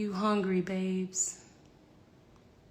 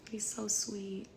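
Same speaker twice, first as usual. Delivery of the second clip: whisper; 3 semitones lower, 12 dB quieter, slower than usual. Real, not acted. Gushing about friend Juan.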